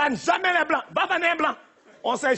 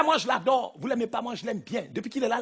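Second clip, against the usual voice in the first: usual speaking voice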